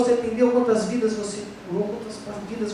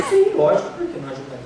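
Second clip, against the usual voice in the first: mock obvious answer; 6 semitones lower, much faster than usual. Answering Kardec's question, mock-certain then trailing off. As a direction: play the naive answer, sure of itself. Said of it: "Bom, resposta".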